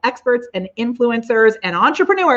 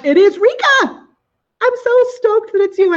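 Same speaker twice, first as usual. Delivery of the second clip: high, loud happy announcement; excited announcement; 13 semitones higher, 4 dB louder than usual. Real, not acted. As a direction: announce the winner with delight. Announcing the winner.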